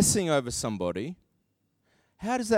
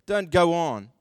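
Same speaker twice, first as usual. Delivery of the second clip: ordinary delivery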